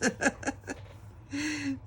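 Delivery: a high, breathy burst of laughter; cracking up at her own swimmers joke. Real, not acted. Laughing at her own joke to viewers, a high burst.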